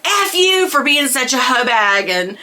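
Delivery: high, heated telling-off; high-pitched rant at the crew, half mocking; angry tirade. Quitting teen unloading on her coworkers, high and heated. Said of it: "I was just unleashing all this".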